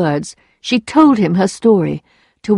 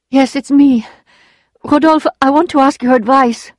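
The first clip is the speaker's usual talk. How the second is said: higher, lightly voiced woman; anxious Emma; 6 semitones higher, 4 dB louder than usual. A narrator's reading. Emma asks Rodolphe for advice, a touch anxious. Emma nervously appeals to former lover.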